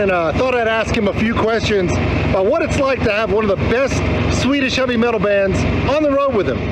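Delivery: high, bright-eyed interviewer bit; hammy reporter voice. Pretend. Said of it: "I don't know why I gave the bus a Texas accent".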